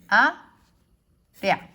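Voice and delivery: "a, rea" in two pieces with a long pause, e and a joined; "a, rea" split into just two syllables; two-syllable synaeresis. Shown as a demonstration. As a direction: say "a", pause, then "rea" as one beat. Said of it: "entonces quedaría así".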